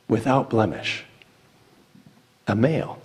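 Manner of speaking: ordinary talk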